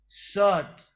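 sad with heavy hissing friction; teacher demo of sad. Shown as a demonstration. Teacher models the letter for students, crisp emphatic hiss. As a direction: hold the hiss on ساد as a long emphatic s. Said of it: "زی دسته دارم سایشی".

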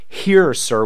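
normal talking voice

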